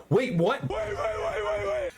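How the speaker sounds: high-pitched mock panic, words tumbling out; panicked protest. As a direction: voice leaps up high in frantic protest. Rabbit's panicked reaction in retold story.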